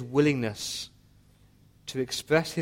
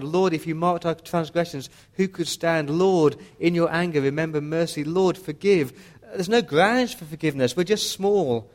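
high, raised, urgent plea; pleading; 4 semitones higher, 5 dB louder than usual. Acted. Prophet pleading with God for mercy, fervent raised plea. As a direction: intercede fervently, voice lifted high. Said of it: "he's pleading with God".

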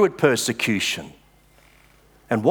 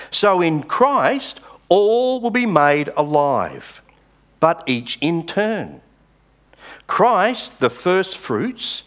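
preacher reading the verses to his congregation; scripture reading; 4 semitones higher, slower than usual. Acted. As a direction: proclaim the verses slowly from the pulpit. Reads verses twenty-two to twenty-four.

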